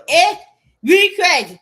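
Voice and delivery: high, loud exclaiming shout; shouted catchphrase. Real, not acted. Ranting to the camera, a loud high shout. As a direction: belt the phrase out.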